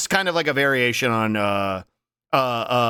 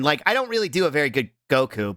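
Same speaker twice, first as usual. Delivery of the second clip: usual speaking voice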